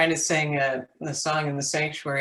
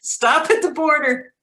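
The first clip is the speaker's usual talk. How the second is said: mock shout; 12 semitones higher, 6 dB louder than usual. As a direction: Stop at the border called out much higher and louder. Repeats the joke louder.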